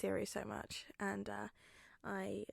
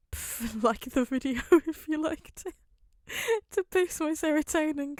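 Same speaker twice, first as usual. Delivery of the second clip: giggling through the words, louder; laughing; 6 semitones higher, 8 dB louder than usual. Real, not acted. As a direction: laugh as you ask for likes.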